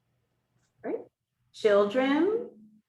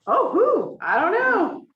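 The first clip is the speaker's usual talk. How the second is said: high, loud nosy "Oh, who?"; pry playfully, as if dying to know; curious gossipy reaction; 9 semitones higher, 4 dB louder, slower than usual. Pretend. Teacher playing a nosy friend who hears she's dating, excited.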